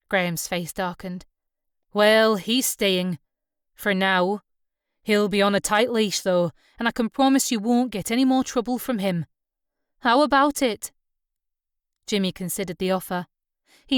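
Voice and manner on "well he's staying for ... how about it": firm skipper, lightly Scots; louder, matter-of-fact Scots boatman. A narrator's reading. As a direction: lay down terms as a Scots skipper.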